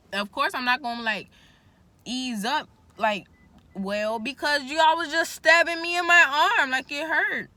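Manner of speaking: exasperated outburst; indignant. Real, not acted. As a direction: complain loudly about the pain. Indignant rant about the stabbing.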